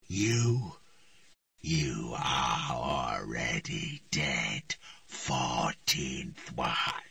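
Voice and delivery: very slow, low, menacing; cold death sentence. A narrator's reading. The voice tells Triss she is already dead, slow and low. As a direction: pronounce it like a final verdict.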